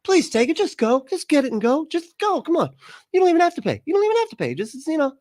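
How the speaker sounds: high, pleading squeaky voice begging you to take it; mock desperate seller. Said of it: "The one where they're like".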